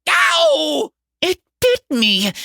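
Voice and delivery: very high cry of pain, easing down; pained yelp. Acted. Kid bitten by the hamster, yelping in pain. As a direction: yelp at a sudden bite.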